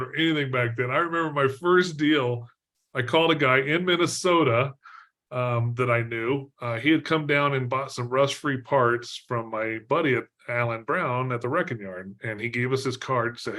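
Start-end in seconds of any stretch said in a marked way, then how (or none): none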